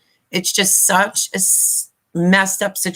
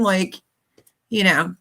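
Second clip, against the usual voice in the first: ordinary talk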